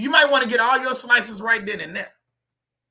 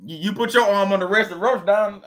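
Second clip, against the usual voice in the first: usual speaking voice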